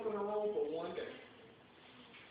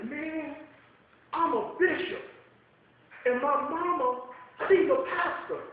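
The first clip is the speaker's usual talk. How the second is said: higher and louder, excited rush; flipped out; 8 semitones higher, 5 dB louder than usual. Retold or imitated. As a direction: retell the bishop blurting it out in excitement. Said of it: "he really flipped out".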